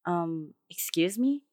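ordinary delivery

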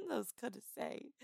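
giggling through the words, breaking character; real laugh at her own line. Real, not acted.

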